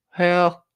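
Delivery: "Hell" pitched high and held; imitated inflection. Host reading Al's chat message back, exaggerated inflection on "Hell". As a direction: mimic a high, drawn-out "Hell". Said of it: "That was your inflection".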